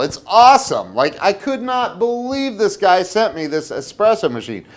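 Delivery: thrilled burst about the espresso machine; excited. Real, not acted. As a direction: gush about the surprise gift.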